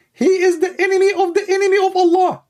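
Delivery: high, squeaky preacher voice; Ali Dawa. Acted. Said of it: "Let us ask Ali Dawa why".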